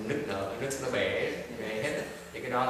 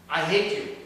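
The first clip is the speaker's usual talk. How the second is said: louder, higher "I hate you"; mock-scold with a raised voice; acted scolding; 5 semitones higher, 7 dB louder than usual. Quoting a scolding.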